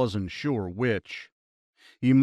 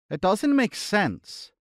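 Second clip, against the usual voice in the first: higher voice with a faint Irish lilt; a touch of Irish accent, pitched up; slightly high Irish; 8 semitones higher than usual. Acted. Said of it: "in a slightly high-pitched voice that had a very faint Irish accent".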